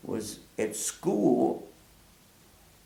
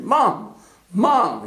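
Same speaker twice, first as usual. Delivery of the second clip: higher, louder calling Mom; 7 semitones higher, 5 dB louder than usual. Retold or imitated. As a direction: call for mom as you run in. Child running in calling mother.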